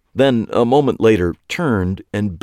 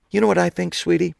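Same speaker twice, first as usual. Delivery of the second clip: sweet teasing; 11 semitones higher, faster than usual. A narrator's reading.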